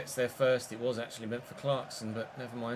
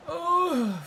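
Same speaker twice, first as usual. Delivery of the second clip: high pitched dismay; 14 semitones higher, 5 dB louder than usual. Real, not acted. Gamer seeing a chance wasted, dismayed. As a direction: let out a high sudden groan.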